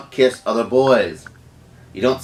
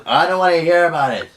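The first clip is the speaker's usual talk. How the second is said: raised voice cutting off excuses; retold angry dismissal; 6 semitones higher, 4 dB louder than usual. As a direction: act out refusing to listen, pitched up. Retold row at home.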